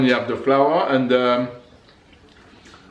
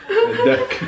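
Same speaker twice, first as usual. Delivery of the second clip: squeaky high. Real, not acted. Joking about a duck.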